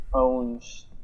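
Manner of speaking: ordinary talk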